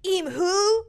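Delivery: first emus hooting to the flock, high loud mock bird call; high, loud questioning emu call. One emu calling to the others, high loud hoot. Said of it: "I feel like the emus were like".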